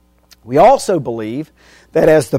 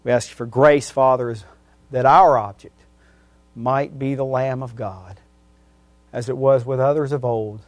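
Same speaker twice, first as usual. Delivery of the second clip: quiet prayer, fading softer and softer; closing prayer; 3 semitones lower, 5 dB quieter than usual. Acted. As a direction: pray humbly, letting the voice sink.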